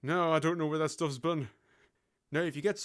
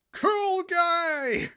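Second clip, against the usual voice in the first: high, loud, long-held cheer; mock admiration; 11 semitones higher, 5 dB louder than usual. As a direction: crow it sarcastically, stretching the last word. Mock cheer after a list line.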